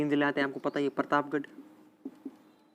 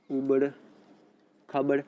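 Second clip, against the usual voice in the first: उबड़, a long pause, then खाबड़; halting, as if writing out उबड़ खाबड़; dictation pace. Real, not acted. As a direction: dictate उबड़ खाबड़ one word at a time. Teacher repeats term while writing it.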